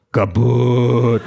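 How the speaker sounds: loud, drawn-out robot word; lumbering combat robot. A narrator's reading. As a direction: boom it out long like a robot.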